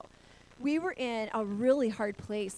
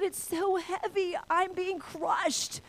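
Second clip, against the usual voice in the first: high, drawn-out tearful wail; imitated crying; 8 semitones higher, much slower than usual. Daughter crushed by circumstances cries to father, wailing. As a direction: wail it slowly under the weight. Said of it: "I had to cry in the dream".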